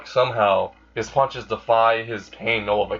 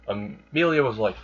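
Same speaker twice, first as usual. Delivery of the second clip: plain reading voice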